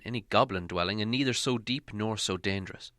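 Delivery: ordinary delivery, nothing marked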